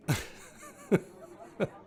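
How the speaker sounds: high, mostly voiceless laugh; real laugh. Real, not acted. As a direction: laugh in spaced bursts. Laughs at Crafty being jumped on.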